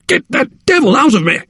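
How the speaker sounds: high-pitched desperate plea; frantic, very high outcry; panicked cry. A narrator's reading. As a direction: cry it out in terror, voice shooting up. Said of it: "cried the patient".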